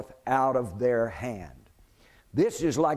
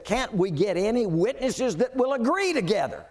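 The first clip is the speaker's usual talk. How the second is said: exasperated high priest; 6 semitones higher than usual. Acted. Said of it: "the high priest tore his clothes and said".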